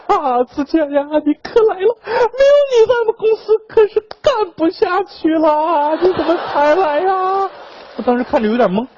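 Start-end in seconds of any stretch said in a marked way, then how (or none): 0.1-7.5 hammed-up, high-pitched sobbing plea; tearful wailing. Boss on his knees begging his employee, in a mock tearful wail. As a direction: wail it out, stretching the last phrases. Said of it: "当时声泪俱下".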